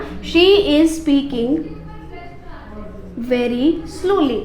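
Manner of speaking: very slow, word by word; slow dictation. Shown as a demonstration. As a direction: dictate slowly to the class. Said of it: "slow dictation of example". (धीरे-धीरे बोलना)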